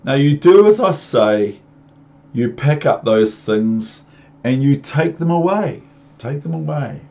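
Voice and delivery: put-on stern boy. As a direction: tell the cat off firmly. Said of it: "Then I said to the cat".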